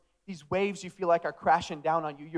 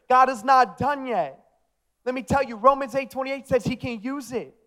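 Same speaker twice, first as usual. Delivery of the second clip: raised, ringing sermon climax; forceful preaching; 4 semitones higher, 5 dB louder than usual. Real, not acted. Preacher declaring God is not done yet, forceful preaching. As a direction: proclaim it with full conviction.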